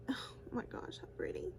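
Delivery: hushed whisper of wonder; soft gasp of admiration; awed whisper. Real, not acted. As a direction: whisper it, amazed by the shine. Awed by shimmer shade.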